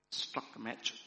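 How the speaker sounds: ordinary talk, nothing marked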